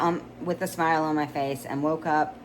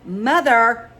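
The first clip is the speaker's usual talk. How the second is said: louder, higher, drawn-out indignant cry; exasperated protest; 6 semitones higher, 10 dB louder than usual. Acted. Daughter protesting her mother's refusal, loud indignant whine. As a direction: whine it in outrage.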